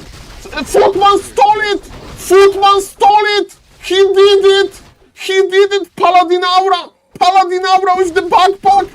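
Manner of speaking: high, loud squealing disbelief; ecstatic shouting. Real, not acted. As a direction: scream it like the best play of the year. Footman steals item, caster erupts.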